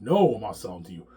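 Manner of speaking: retold defiant refusal; firm refusal. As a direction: stand your ground and refuse. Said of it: "I'm like".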